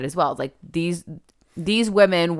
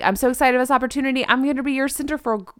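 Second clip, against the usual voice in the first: higher, very fast eager rush; excited gush; 7 semitones higher, much faster than usual. Retold or imitated. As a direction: gush like an overeager new hire. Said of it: "And she's excited".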